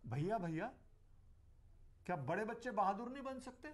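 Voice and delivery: imitated child actor, questioning lift at the end; child's recited dialogue. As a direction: recite it like a little girl's learned line. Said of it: "उसका एक dialogue था".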